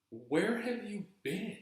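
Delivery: high, slow, drawn-out disbelief; incredulous demand. Retold or imitated. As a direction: stretch the question out, high and incredulous. Demanding where the missing man was.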